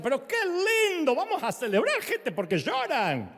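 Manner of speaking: loud, high, laughing celebration call; rally the mourners with a laugh; cheerful pastor laughing. Retold or imitated. Pastor Ortiz laughingly urges mourners to celebrate, loud and high. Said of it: "Juan Carlos siempre hacía eso".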